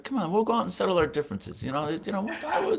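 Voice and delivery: imitated high-pitched cajoling; insistent coaxing. Manager pulling writer out to settle differences, coaxing. Billy urging him to settle it.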